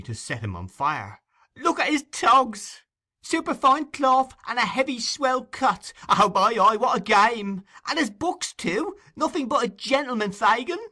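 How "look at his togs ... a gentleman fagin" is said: high, loud cheeky youngster; excited boy. Acted. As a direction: crow it like a delighted street boy. Charlie continues mocking Oliver.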